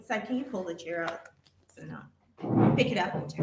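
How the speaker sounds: ordinary talk, nothing marked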